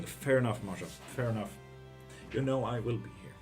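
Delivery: ordinary delivery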